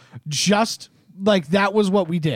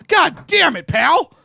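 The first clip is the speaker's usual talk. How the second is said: mock frustration; 9 semitones higher, 4 dB louder, slower than usual. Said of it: "it's just like".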